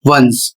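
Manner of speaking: वंस said as "wuns", starting on a W; वंस as a clear classroom example; careful English pronunciation. Shown as a demonstration. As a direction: model वंस with a W sound in front. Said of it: "जो उच्चारण होगा".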